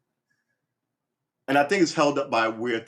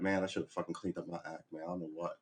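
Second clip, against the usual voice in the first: much softer rueful admission; regretful guy; 5 semitones lower, 12 dB quieter than usual. Retold or imitated. Guys regretting a breakup, much softer and rueful. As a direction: confess it quietly to yourself. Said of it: "I heard guys say that".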